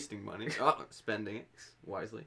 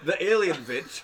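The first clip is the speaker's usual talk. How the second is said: laughing; 7 semitones higher, 11 dB louder than usual. Real, not acted. Laughing joke about green girl.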